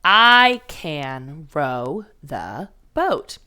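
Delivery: very slow, every word spaced apart; reading along with the first graders; slow choral reading. Shown as a demonstration. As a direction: lead the class through it slowly. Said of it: "Say it with me".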